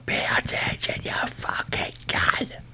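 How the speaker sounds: harsh whisper, slow on the last words; whispered scream. Retold or imitated. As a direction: whisper the yell instead of shouting it. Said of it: "So I scream at them".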